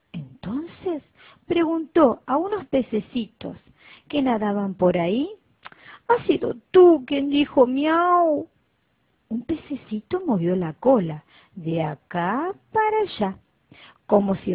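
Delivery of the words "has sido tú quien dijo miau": voz de cachorrito. Acted. El cachorrito pregunta a los peces.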